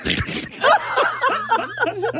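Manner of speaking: high breathy laughter; host laughing after the prank call; hearty laugh. Real, not acted. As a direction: crack up at the prank.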